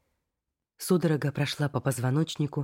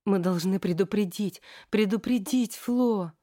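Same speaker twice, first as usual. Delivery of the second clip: high, slow panicked insistence; frantic repeating; 6 semitones higher, slower than usual. Acted. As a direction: repeat it like a wound-up toy. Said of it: "повторяя, как заведённо".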